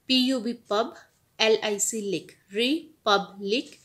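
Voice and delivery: ordinary delivery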